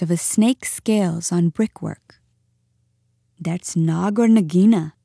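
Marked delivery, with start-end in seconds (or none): none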